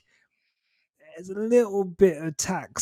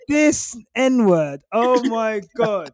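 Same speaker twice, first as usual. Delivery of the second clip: exasperated quote; 7 semitones higher, 5 dB louder, much slower than usual. Retold or imitated. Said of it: "I'm like".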